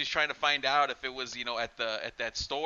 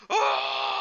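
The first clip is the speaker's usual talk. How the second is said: retold yell; 6 dB louder than usual. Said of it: "He's like".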